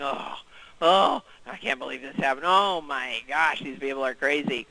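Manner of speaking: glum moaning. Retold or imitated. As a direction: sigh it out like you're buried in work. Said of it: "buried and glum and hemming and hawing".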